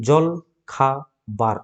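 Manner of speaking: জল, খা, বার with a pause between each; syllable-by-syllable জল, খা, বার; split into syllables. Shown as a demonstration. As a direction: break জল খা বার into three separate beats. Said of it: "তিনভাবে উচ্চারণ করছি আমরা".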